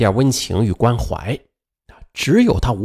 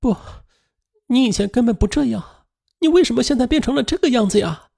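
hurt pleading; 9 semitones higher than usual. A narrator's reading. Wife pleads with cold husband.

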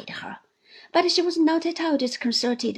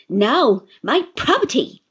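aunt insisting; 8 dB louder than usual. Acted. Aunt insists to Uriah, loud and firm. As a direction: demand it back. Aunt demanding her property.

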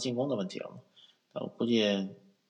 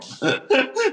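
delighted laugh; 8 dB louder than usual. Real, not acted. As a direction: burst of high laughter. Excited about Blazers rim protection. (开心大笑)

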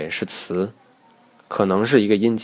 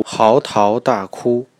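"嚎啕大哭" slowly, every syllable equally timed; even, connected syllables; 2 semitones higher, much slower than usual. Shown as a demonstration. Teacher showing mother-tongue rhythm, "嚎啕大哭" evenly connected. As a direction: read "嚎啕大哭" evenly with no break inside. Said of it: "每个字都是匀速连贯读完".

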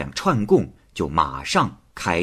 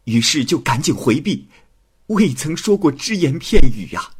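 put-on slightly higher, pleading young man before the judge; frightened accused young man; 7 semitones higher than usual. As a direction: plead timidly to the magistrate. 鄂秋隼向太守申辩. (向大人惶恐申辩的书生)

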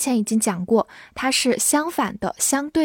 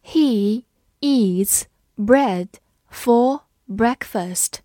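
slow, word by word, pause after each; stretched-out words with clear gaps; slow read-along; much slower than usual. Shown as a demonstration. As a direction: read slowly for learners to follow. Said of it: "跟着我慢读一遍".

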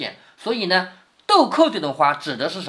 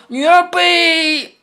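high, loud and hugely stretched syllables; drawn-out recitation; 10 semitones higher, 6 dB louder, much slower than usual. Acted. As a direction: proclaim it slowly, holding the last word high. 薛蟠瞪眼半天才说. (高声拖长腔念酒令)

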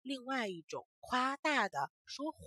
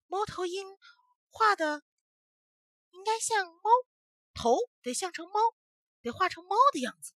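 put-on high confident child voice; 10 semitones higher, 6 dB louder than usual. Boy explaining confidently.

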